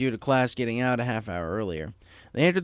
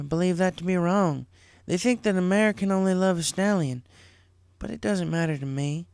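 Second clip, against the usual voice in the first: a bit higher, earnest big sister; lightly voiced sincere Applejack; 7 semitones higher than usual. Applejack explaining her love to Apple Bloom, lightly voiced sincere. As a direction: explain something hard honestly.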